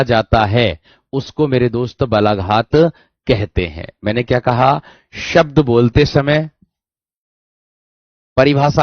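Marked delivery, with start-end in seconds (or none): none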